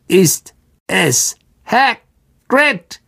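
put-on high, slow, clicking old spider; Aragog. A narrator's reading. The blind old spider asking who came, high clicking creature voice. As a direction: chop every syllable apart like pincers clicking. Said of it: "klickte schnell mit ihren Greifern".

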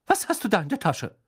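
high, suspicious demand; stern interrogation. Acted. Mother asks son about his pocket, high and suspicious. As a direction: question him like a mother who knows.

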